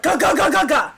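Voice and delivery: loud stuttering "ga" knee noise; imitated knee noise. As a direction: imitate a joint juddering back in place. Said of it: "So it literally went".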